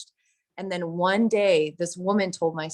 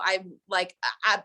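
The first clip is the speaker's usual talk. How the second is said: normal talking voice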